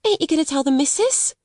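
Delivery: put-on high, worried servant girl; anxious maid. A narrator's reading. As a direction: plead not to be reported. Scared maid pleading.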